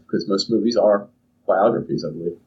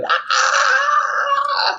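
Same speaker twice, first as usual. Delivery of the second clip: very high squeal of delight; joyful shriek. Acted. Joseph squealing after getting the raise, thrilled shriek. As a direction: let out a thrilled yelp. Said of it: "he's like".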